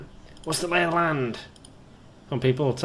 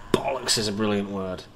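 ordinary delivery

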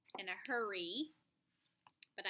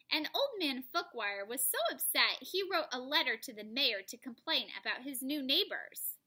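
louder animated read-aloud; lively story narration; 3 semitones higher, 6 dB louder than usual. A narrator's reading. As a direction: read it with big storybook energy.

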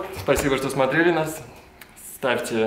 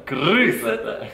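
drawn out; 4 semitones higher, 7 dB louder, much slower than usual. Acted. Pun drawn out.

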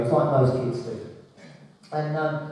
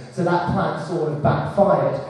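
normal talking voice

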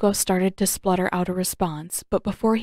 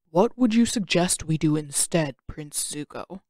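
put-on lower, unhurried wise old uncle voice; calm old uncle; 3 semitones lower than usual. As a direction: speak as a patient old mentor.

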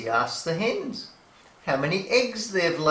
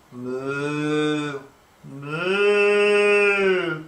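mooing cows; 4 dB louder than usual. Acted.

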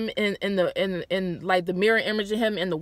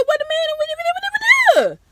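much higher stammering what what; confused disbelief; 7 dB louder, faster than usual. Retold or imitated. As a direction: sputter in rising confusion. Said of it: "I'm just like".

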